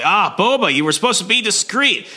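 high, breezy hero voice; cocky Valance voice. Retold or imitated. Valance scolding Boba for blowing cover, high and breezy. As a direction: play the dashing young hero, all swagger. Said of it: "he's like".

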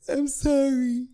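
high, small whimpered apology; whiny little-kid sorry; caught five-year-old. Retold or imitated. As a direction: apologize like a chastened child. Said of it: "I'm the five-year-old caught with the cookie".

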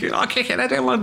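a hint of a scally yelling from the van; Scouse scally threat. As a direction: lightly imitate a young Liverpool scally kicking off. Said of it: "translate for young scallies".

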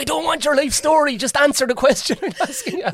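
high, loud exasperated complaint; couple bickering. Retold or imitated. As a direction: snap back, fed up and loud. Retold partner's complaint, loud.

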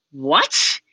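retold stunned "what"; mock disbelief at sudden news; astonished. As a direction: act out a friend caught totally off guard. Friend stunned by house-hunting news.

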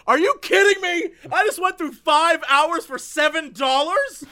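incredulous angry outburst, slowing on the end; livid. Retold or imitated. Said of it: "I was so livid".

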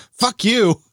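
high yelled curse; yelling. Retold or imitated. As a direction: shout it at him. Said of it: "Gail yells".

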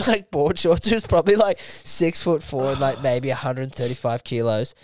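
usual speaking voice

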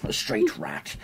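low, soft villain voice; Jafar. Retold or imitated. Host playing along with the teasing, low Jafar voice. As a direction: sneak in a low villain impression. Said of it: "like Jafar from Aladdin".

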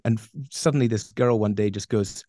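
ordinary talk, nothing marked

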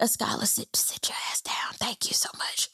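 hushed, whispered telling-off; sassy whisper under the voice; whispered aside. Retold or imitated. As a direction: whisper it as a mock private scolding. Mock aside to cast member.

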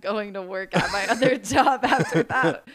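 real laugh breaking through the words; can't hold back the giggle; laughing while telling. Real, not acted. Laughing while retelling her answer.

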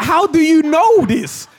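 high, loud, drawn-out mock protest; retold indignant shout at the director; exasperated outburst. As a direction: cry it out in disbelief, stretching the words. Acted exasperated reply to director.

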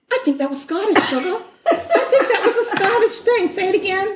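high, giggling through the words; cracking up at her friend's accent; laughing. Real, not acted. Teasing the cohost's Scottish try, high and laughing.

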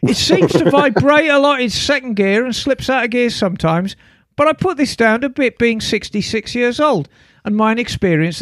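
hammed-up Essex accent. Reading Chris from Essex's email aloud, put-on Essex accent. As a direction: read it out like an Essex bloke. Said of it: "Can you do that accent".

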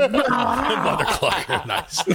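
slow, high, laughing Mother clucker. Real, not acted. Host laughing while repeating the chicken pun.

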